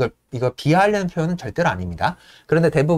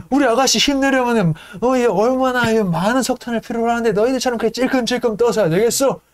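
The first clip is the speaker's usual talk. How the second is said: higher, swaggering stoker voice; Yank impression; 8 semitones higher than usual. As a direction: boast about the ship like your lady. Yank voiced lecturing crew. (높은 목소리로 으스대는 양크 흉내)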